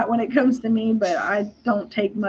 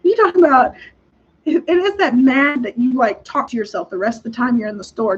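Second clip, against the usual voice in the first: ordinary talk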